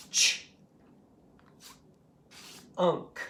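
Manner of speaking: CH alone, long pause, then UNK; tapped into sounds. Shown as a demonstration. Teacher reviewing the word chunk, CH and UNK far apart. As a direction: break chunk into CH and a glued UNK. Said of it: "if I'm doing that word".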